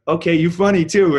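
high, loud teasing quip; playful, loud retort to a cheeky compliment; amused comeback. Retold or imitated. Kevin jokes back, amused.

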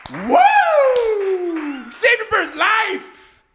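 high, loud, stretched victory cheer; a drawn-out celebratory yell, slow and loud; triumphant whoop. Real, not acted. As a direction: whoop it out like you just won. Celebrating saving the bird.

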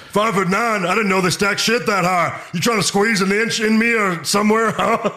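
high, loud barking sergeant; drill sergeant. Acted. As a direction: bark it down at a recruit. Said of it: "Do you wanna be Private Cowboy".

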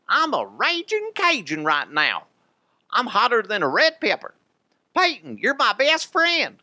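hammed-up raging Bayou boast; Brandon Stokley Cajun voice. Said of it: "It's a horrible Stokley impression".

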